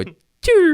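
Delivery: a sharp, very high and loud choo of the car sliding; whooshing skid noise. Retold or imitated. Car crashing into the ditch, high loud whoosh. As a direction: fling out the skid sound.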